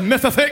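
Mississippi with th for every s, Mithithippi; mock lisp on Mississippi. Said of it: "They can't say their S's".

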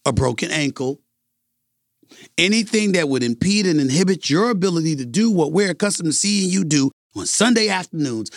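ordinary talk

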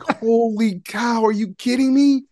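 high, stretched-out amazed exclamation; retold stunned kid. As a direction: gasp it out like a starstruck eleven-year-old. Kid stunned meeting the president.

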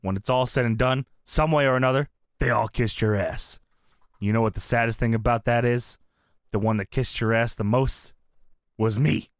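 low, solemn wrestler promo; Taker. Retold or imitated. Wrestler telling his boss who kissed up most, low solemn voice. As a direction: lightly imitate a sombre wrestler confessing. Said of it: "And Taker says".

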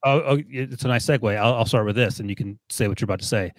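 ordinary delivery

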